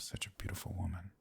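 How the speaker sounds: intimate flirting whisper. Acted. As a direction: lean in and whisper the compliment. Flirting, inviting her on date.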